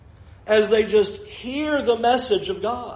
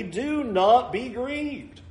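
slow solemn reading; 4 semitones higher, 3 dB quieter, much slower than usual. Real, not acted. Pastor reading the Levites' words to the congregation, slow and solemn. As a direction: draw out do not be grieved slowly. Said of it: "reading scripture slowly".

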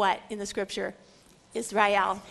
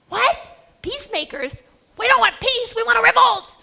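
indignant crowd; 9 semitones higher, 9 dB louder than usual. Acted. Crowd objecting to peacemakers.

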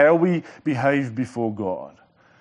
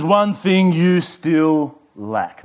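slow; 4 dB louder, much slower than usual. Acted. Jesus quoted, drawn out.

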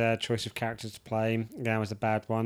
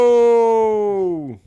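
loud, drawn-out mock Vader cry; 7 semitones higher, 9 dB louder than usual. Friend retelling the Return of the Jedi ending, big mock cry. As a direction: belt out a hammed-up Vader no. Vader shouting in retold scene.

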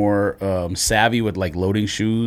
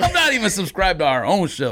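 laughing; 6 semitones higher than usual. Real, not acted. Laughing at himself.

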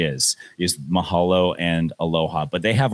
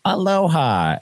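chirpy tourist; 10 semitones higher, slower than usual. Retold or imitated. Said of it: "old retiree from Minnesota who's like".

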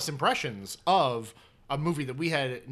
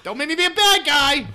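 gangster impression; 11 semitones higher, 7 dB louder than usual. Continuing Pesci-style quote.

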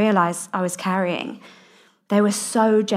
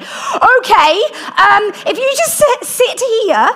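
high, loud panicked squeak; mock flustered vicar; 14 semitones higher, 6 dB louder, slower than usual. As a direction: imitate a startled vicar, pitched way up. Retold flustered vicar reacting to confession.

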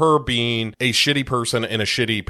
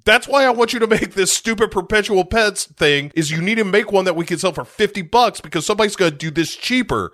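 high, pushy boss voice; hammed-up greedy boss; mock boss rant; 8 semitones higher than usual. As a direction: demand it like a cartoon boss. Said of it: "He's like".

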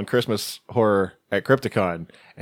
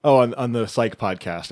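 ordinary delivery

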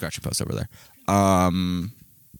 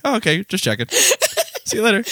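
breezy high sign-off; 11 semitones higher than usual. Retold or imitated. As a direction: trail off airily up high. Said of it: "And I was like".

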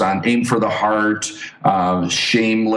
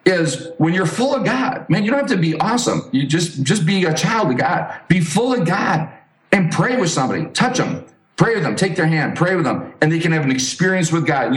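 raised preaching exhortation; 6 semitones higher than usual. Acted. Pastor urging podcast listeners to pray with strangers, preaching higher.

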